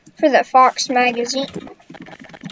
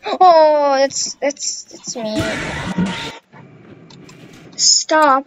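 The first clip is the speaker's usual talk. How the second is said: ordinary talk, nothing marked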